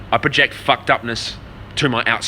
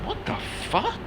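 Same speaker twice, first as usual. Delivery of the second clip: high, soft stunned disbelief; squeaky high shocked mutter; what the fuck; 9 dB quieter than usual. Retold or imitated. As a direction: gasp it out high and breathless, baffled. Reacting to grandmas ganging up.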